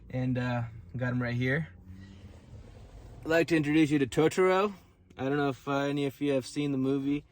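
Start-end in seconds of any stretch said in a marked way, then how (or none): 3.2-4.7 loud, fast showcase announcement; game show reveal of Totoro; excited introduction. Acted. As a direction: present the toy with a flourish. Presenting toy to camera.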